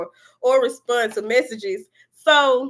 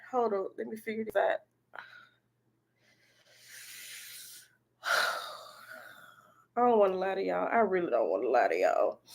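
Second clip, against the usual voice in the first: softer, lower muttered aside; muttering to herself; 5 semitones lower, 9 dB quieter, faster than usual. Real, not acted. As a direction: drop the voice and talk to yourself.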